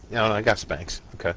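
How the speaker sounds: ordinary talk, nothing marked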